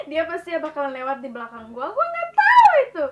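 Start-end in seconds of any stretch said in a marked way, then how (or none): 1.9-3.0 high, loud startled protest; surprised exclaim. Real, not acted. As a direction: blurt it out in disbelief. Surprised outburst.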